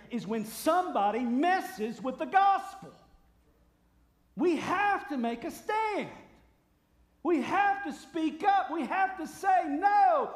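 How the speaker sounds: loud, high, building to a shout; rally them fiercely to take a stand; preaching at full force. Real, not acted. Preacher urging the church to defend the gospel, impassioned shouting.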